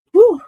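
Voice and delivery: loud, high whoop of breath; overwhelmed whew. Real, not acted. Reader recovering from an upsetting card, overwhelmed whew. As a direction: let out a sharp, high "whew". Overcome, pausing after the upsetting reading.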